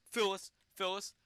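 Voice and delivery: ordinary talk, nothing marked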